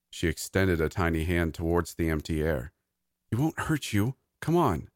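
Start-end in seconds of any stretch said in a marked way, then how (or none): 3.3-4.9 put-on higher-pitched small child, lightly hushed; hushed little girl. A narrator's reading. Maisie reassures her invisible friend about her uncle, lightly hushed child. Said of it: "Her hushed voice drifted up to my ears".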